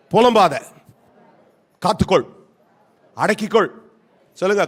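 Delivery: ordinary delivery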